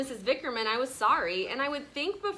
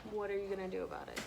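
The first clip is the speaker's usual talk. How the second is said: quiet correction; 2 semitones lower, 13 dB quieter, much faster than usual. Real, not acted. Teacher quietly correcting child.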